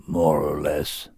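low, very slow creature drawl; low slow scornful beast. A narrator's reading. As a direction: sneer at the humans. Sorin frowning.

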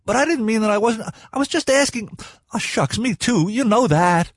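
high-pitched, stumbling protest; flustered boy. A narrator's reading. Art backtracks to the other two, flustered and high. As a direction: pitch it way up, anxious to be included. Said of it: "Art looked wildly at the other two".

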